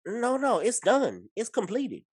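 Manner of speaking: very high, insistent rebuttal; emphatic reassurance. Real, not acted. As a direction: insist on it, pitch shooting up. Rebuts worry about rushed movie.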